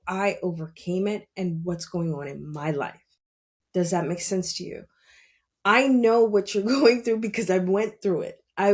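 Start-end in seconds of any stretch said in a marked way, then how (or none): 3.7-4.8 hushed aside to the viewer; whisper. Real, not acted. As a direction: drop to a whisper for the question.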